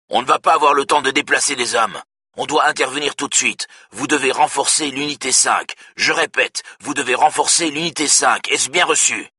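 forceful voice. A narrator's reading. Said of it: "Brolin dit d'une voix forte".

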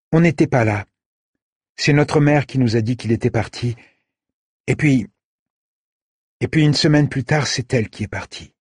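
put-on a girl recalling her parents leaving; sad girl. A narrator's reading. The sister answering Said about their parents, subdued.

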